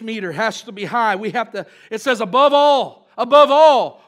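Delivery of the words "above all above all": louder, ringing emphasis on each ALL; emphatic preaching. Real, not acted. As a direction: drive the repeated phrase home loudly. Preacher emphasizing a scripture quote.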